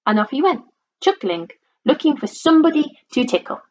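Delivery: usual speaking voice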